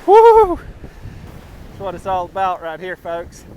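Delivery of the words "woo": very high loud whoop; excited whoop. Real, not acted. Cheering at catch.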